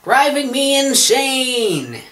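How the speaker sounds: very high, slow stretched-out phrase; exaggerated idiom. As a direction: wail the idiom in mock outrage.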